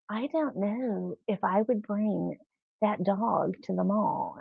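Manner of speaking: slow, quiet mock disapproval; disapproving girl. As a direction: imitate a hesitant, judging onlooker. A girl somewhere was like.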